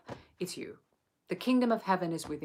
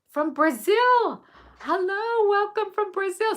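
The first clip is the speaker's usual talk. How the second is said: much higher, louder, drawn-out hello; excited welcome; 8 dB louder, slower than usual. Real, not acted. Teacher greeting a new viewer from Brazil, ecstatic welcome. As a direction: sing out a delighted welcome.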